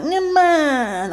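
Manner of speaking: high, drawn-out coaxing "Come on."; a pushy suitor's whiny "Come on."; imitating the guy brushing off her no; mock wheedling guy. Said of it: "I don't know if he was doing that voice".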